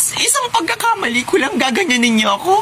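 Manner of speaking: very high, wailing grievance; hammed-up tearful whine; crying. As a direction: weep at being treated unfairly. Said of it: "Ang pangit umiyak".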